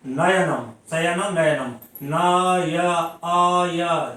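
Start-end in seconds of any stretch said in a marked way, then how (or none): 2.0-4.1 न and य stretched out very slowly, one syllable at a time; syllables split slowly. Shown as a demonstration. Teacher to students, breaking नयनम into very slow syllables. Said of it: "नय में अय का उच्चारण हो रहा है".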